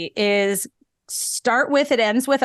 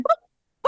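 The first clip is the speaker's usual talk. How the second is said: high, quick comic boop; popping sound effect. Shown as a demonstration. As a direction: pop it out like a cartoon. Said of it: "How does that go?".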